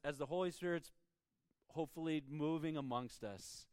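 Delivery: usual speaking voice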